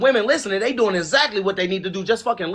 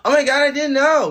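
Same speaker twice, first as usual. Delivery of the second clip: mock innocence; 7 semitones higher, 4 dB louder than usual. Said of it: "I know how y'all".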